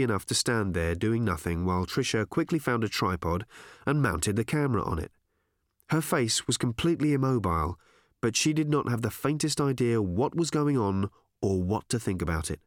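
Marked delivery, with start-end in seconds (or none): none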